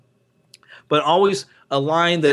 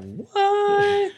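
much higher squeaky shocked what; high-pitched what. Retold or imitated. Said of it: "I just actually did the whole "what" thing".

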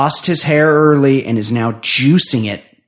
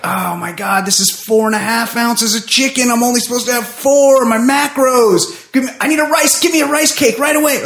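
high, fussy diet-obsessed voice; fitness-obsessed girlfriend; 8 semitones higher than usual. Retold or imitated. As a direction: whine like a panicked calorie counter. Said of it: "they're like".

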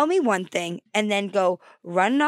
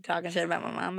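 ordinary talk

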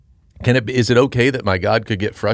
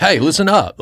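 higher-pitched, mock exasperated call for attention; imitating mild frustration, pitched up; a little frustrated; 8 semitones higher, 3 dB louder than usual. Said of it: "gets a little frustrated with his disciples".

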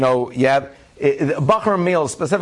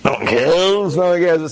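the bored table's mock exasperation, announced; mock exasperated; 4 semitones higher, slower than usual. Guests' unspoken reaction.

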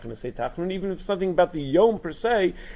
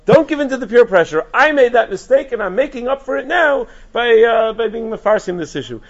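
much higher, louder urging; fervent plea; 8 semitones higher, 8 dB louder than usual. Retold or imitated. Rabbi urging children against peer pressure, high and loud. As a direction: plead with the kids not to give in. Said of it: "But he says".

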